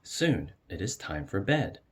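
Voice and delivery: ordinary delivery, nothing marked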